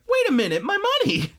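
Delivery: high, loud outburst rising to a squeal; high indignant shriek over lost money; mad about money. Retold or imitated. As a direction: yelp it in sudden outrage. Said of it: "she's so mad".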